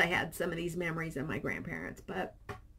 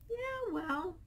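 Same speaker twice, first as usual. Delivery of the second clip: high voiced guest; 13 semitones higher, 4 dB quieter than usual. Voicing the guest.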